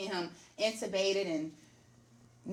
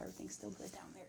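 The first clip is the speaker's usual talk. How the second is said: whispered aside; 12 semitones lower, 18 dB quieter, faster than usual. Pretend. Joking aside after C-section talk.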